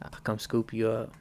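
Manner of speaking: low, soft seductive voice; sexy voice. Pretend. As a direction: pour on the smooth lover-man charm. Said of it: "you like that sexy voice".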